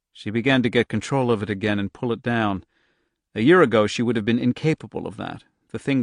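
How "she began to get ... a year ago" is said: ordinary delivery, nothing marked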